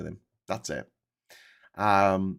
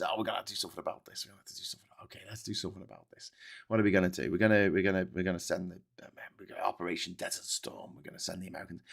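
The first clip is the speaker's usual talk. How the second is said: low, very soft grumbled quote; mock reluctant muttering; 4 semitones lower, 9 dB quieter, much faster than usual. Americans deciding on Desert Storm, low reluctant mock muttering. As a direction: mutter it half to yourself.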